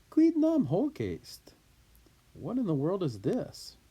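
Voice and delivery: emphatic disbelief. Acted. Julius reacting to the letter, incredulous and emphatic. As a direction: demand it in astonishment. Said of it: "it just adds more emphasis".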